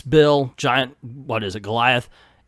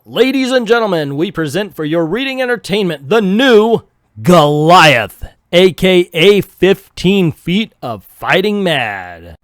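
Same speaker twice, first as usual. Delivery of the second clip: circus announcer; 2 semitones higher, 8 dB louder than usual. Acted. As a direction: announce him like a big top showman. Announcer presenting the new Goliath.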